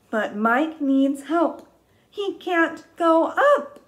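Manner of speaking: high, loud, slow worried storybook voice; hammed-up storytime worry; dramatic read. Shown as a demonstration. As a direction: make the danger big for kids.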